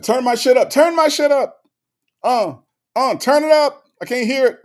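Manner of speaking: hammed-up pumped fan voice; excited fan. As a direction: squeal it like an eager fan begging for volume. Reading chat request excitedly.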